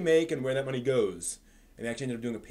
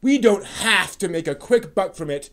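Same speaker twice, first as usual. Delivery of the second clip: put-on loud, slow and higher, lecturing the kids; hammed-up holier-than-thou sermon; mock pompous reading; 5 semitones higher, 10 dB louder, slower than usual. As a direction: read it like a smug scold. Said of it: "someone has a chip on, on their shoulder".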